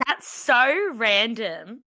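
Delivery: drawled TV catchphrase impression; Summer Heights High. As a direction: echo the character's line, very drawn out. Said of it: "one line from Summer Heights High".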